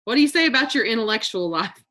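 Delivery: ordinary delivery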